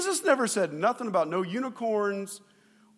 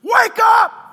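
high, loud yell to wake him; frantic shout; 13 semitones higher, 14 dB louder than usual. Retold or imitated. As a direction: scream it over the thunder. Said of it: "picture them going over to Jesus".

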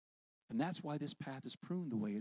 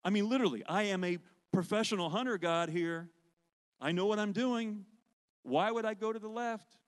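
louder, higher frustrated protest; 5 semitones higher, 4 dB louder than usual. Retold or imitated. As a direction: argue with God, exasperated. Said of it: "I'm getting angry with God".

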